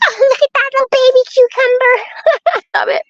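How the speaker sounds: high delighted laugh while talking; delighted squeal. Real, not acted. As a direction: gush at the tiny cucumber, laughing. Finds a tiny cucumber.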